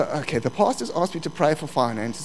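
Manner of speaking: ordinary talk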